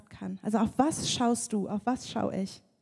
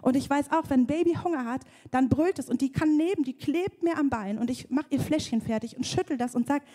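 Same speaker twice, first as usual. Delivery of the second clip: normal talking voice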